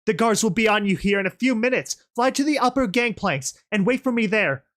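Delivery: high, loud orders to a panicked crowd; urgent shout. Acted. Rebel sending panicked ponies to the gangplanks, shouting. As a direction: shout them into moving. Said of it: "I turned to shout at the panicked ponies".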